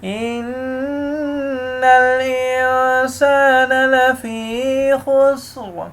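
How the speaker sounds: slow, melodic tajweed recitation; Quran recitation. Acted. Teacher recites the whole surah for students, melodic chant. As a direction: chant the surah slowly and evenly.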